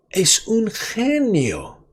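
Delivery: high, slow, gushing; excited praise. Acted. The boyfriend praises the florist, high and slow. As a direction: praise him like a hero. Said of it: "Y él grita".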